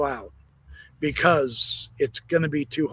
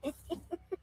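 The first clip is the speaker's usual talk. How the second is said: breathy high laugh at own joke; high giggle; 12 semitones higher, 18 dB quieter than usual. Real, not acted. Laughing at his own joke for viewers. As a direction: cackle at the punchline.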